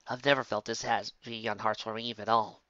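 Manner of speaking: plain reading voice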